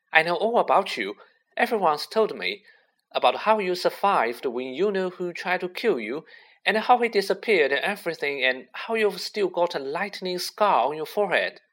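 put-on high-pitched eager young fan. A narrator's reading. Colin telling Harry all he knows about him, eagerly. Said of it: "said Colin Creevey eagerly".

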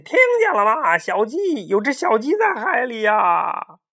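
high, loud cry for help across the sea; calling for help. Acted. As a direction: shout to the passing ships. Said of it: "他大声呼救".

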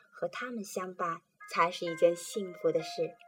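plain reading voice

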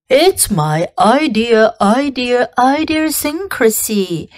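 a goose-like voice fumbling the word; stammering gander. Acted. Gander stammers his excuse.

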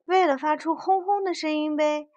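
high, gleeful toy car; happy. A narrator's reading. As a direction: reel it off cheerfully, rising in excitement. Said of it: "小汽车很开心地回答".

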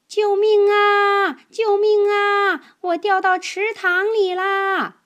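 high, loud, drawn-out cry for help. Acted. The hidden voice pretends to fall in the pond, loud mock cry for help. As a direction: wail it like someone drowning, but playful. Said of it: "那个声音叫着说".

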